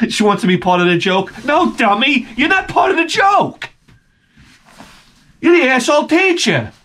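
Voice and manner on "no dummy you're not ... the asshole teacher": much higher taunting put-down; scornful high taunt; mocking scorn. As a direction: jeer at the dim teacher. Mocking the teacher.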